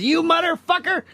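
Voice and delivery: high mock yell at a friend; imitated yell. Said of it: "yelling at me".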